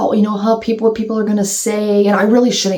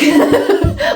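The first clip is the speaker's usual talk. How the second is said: high giggle; amused laugh. Real, not acted. Vlogger taking the story way back, amused laugh. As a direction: giggle at your own tangent.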